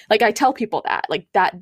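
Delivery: ordinary delivery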